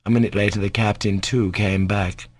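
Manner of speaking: ordinary delivery, nothing marked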